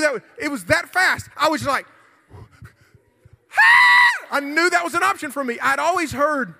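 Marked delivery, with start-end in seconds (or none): 2.3-4.3 abrupt high-pitched full scream; re-enacted shriek to startle an attacker; loud, very high burst of screaming; demonstrated scream. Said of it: "just start screaming".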